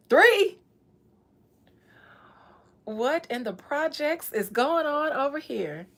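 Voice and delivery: high, loud disbelief; outraged shock. Real, not acted. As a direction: shriek it in comic outrage. Shocked by baby mama count.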